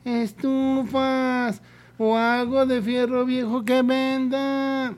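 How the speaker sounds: slow, high sing-song street vendor call; crackly looped vendor recording. Retold or imitated. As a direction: stretch it out like the looped scrap-buyer loudspeaker. Continuing the vendor recording imitation.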